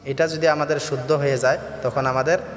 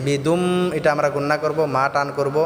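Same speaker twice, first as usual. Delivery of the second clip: Quran recitation. Shown as a demonstration. Recites verse.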